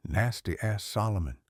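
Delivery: plain reading voice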